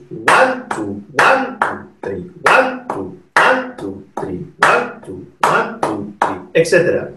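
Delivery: counting out one two, one two three with a beat on each one; steady demo count, leaning on each one; counting a five. Shown as a demonstration. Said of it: "a five would be a combination of".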